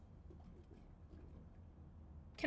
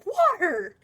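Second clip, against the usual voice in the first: excited shout; 8 semitones higher, 7 dB louder than usual. Retold or imitated. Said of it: "and I was like".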